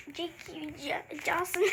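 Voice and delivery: secretive under-the-breath name; whispered aside. Real, not acted.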